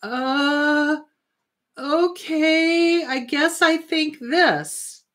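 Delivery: put-on a meek kid giving in, slowly; lightly voiced inner child caving in; unsure inner child. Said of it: "is gonna go".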